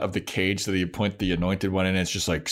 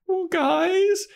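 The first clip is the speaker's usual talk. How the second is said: much higher, whiny plea from the closet; mock helpless girls. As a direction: squeak it out like a stranded teen. Mocking Willow and Cordelia in closet.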